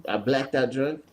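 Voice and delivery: usual speaking voice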